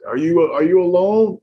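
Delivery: acted-out urgent exchange after the crash; anxious question. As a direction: check if they're alone, alarmed.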